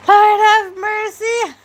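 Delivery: high, squealing delight, drawn out slowly. Real, not acted. Host cracking up at a viewer's joke, squealing like a kid in a candy store. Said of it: "You hear how I sounded like a kid in a candy store".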